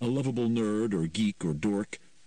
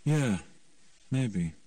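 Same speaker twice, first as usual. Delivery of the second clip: unenthusiastic; 4 semitones higher than usual. A narrator's reading. Mickey reluctantly agrees with Ema, unenthusiastic. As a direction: concede it without much conviction. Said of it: "didn't say it with much enthusiasm".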